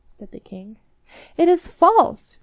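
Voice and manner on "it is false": high, loud, slow denial; crying out. Acted. Minister's son denies the captain's claim, loud slow cry. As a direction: hurl the denial out. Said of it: "cried the minister's son".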